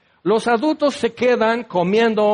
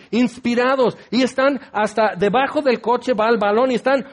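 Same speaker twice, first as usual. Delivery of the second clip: usual speaking voice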